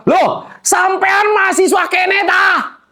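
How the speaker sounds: put-on high, slow, shouted Javanese-accented astonishment; Javanese surprise. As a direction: gasp it out as a stunned Javanese driver. Javanese becak driver, surprised. (kaget dengan logat Jawa, tinggi dan lambat)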